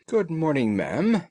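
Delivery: cheerful host welcoming a morning visitor; cheerily. A narrator's reading. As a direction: greet her with real cheer. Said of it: "said Holmes cheerily".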